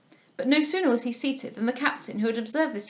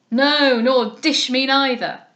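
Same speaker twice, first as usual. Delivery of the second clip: very loud, slow blustering reply; loud retort; 2 semitones higher, 9 dB louder, much slower than usual. A narrator's reading. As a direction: snap back like a bully. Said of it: "answered the captain".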